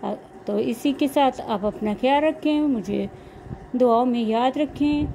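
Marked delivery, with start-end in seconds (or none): none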